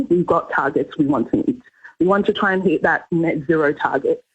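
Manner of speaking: ordinary delivery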